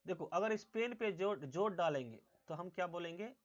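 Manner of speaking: normal talking voice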